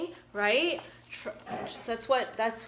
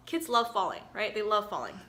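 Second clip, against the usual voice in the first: ordinary delivery, nothing marked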